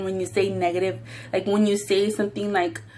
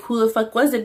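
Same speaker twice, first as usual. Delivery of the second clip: normal talking voice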